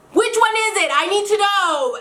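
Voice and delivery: high-pitched, insistent mock plea; exasperated demand. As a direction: act out demanding a straight answer, voice pitched right up. Retelling her exasperated demand for an answer.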